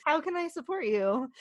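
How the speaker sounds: high, eager caring voice; mock-concerned friend offering support; eager helper. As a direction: lean in like an overeager helper. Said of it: "a person who wants to pull up a chair".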